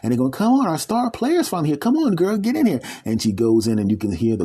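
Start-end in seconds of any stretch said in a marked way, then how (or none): 0.3-2.8 high, excited cheer calling her in; excited team welcome. Acted. Basketball team cheering their new star player.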